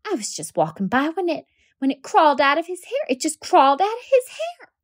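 high, rising desperate teacher; teacher stammering about the creature in a boy's hair, pitched high. Acted. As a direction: blurt it out, rattled and pleading. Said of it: "Miss Caroline said desperately".

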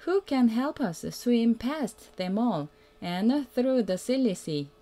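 ordinary delivery

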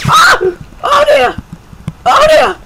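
very high, loud shriek; real panicked scream. Real, not acted. Gamer caught by surprise in game, screaming. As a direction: scream it out in sudden fright.

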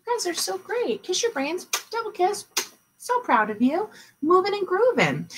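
bubbly teacher praising her class; excited praise. Real, not acted. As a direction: cheer them on, pitch way up.

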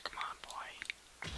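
slow, breathy whispered urging. Real, not acted. Gamer stalking his rival, slow whispered taunt. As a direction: coax it under your breath. Waiting on opponent.

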